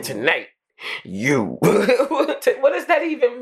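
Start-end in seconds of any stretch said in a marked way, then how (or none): 0.0-1.6 much lower, slow, ominous; a low, creepy threat with a pause; menacing dog voice. Retold or imitated. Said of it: "He said".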